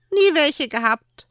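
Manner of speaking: put-on high, loud small child. A narrator's reading. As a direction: shrug it off as a little boy.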